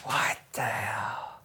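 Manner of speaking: slow, voiceless whisper of disbelief; baffled whisper. Real, not acted. Viewer baffled by the film's random turn, whispering. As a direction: whisper it slowly, stunned.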